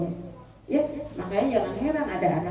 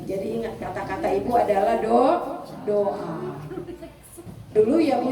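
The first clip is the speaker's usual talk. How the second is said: normal talking voice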